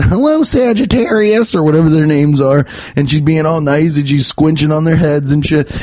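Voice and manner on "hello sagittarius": lightly voiced sweet cat lady; a hint of a doting cat lady greeting a cat; gently mimicking the cat lady. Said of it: "she's petting them all and saying".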